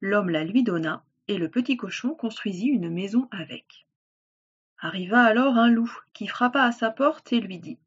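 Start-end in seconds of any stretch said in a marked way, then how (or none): none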